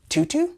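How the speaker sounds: high, questioning "Tutu"; "Tutu" with two oo sounds, unsure and high; mispronounced name. Retold or imitated. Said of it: "my name not being my name".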